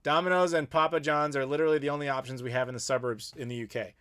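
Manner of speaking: ordinary talk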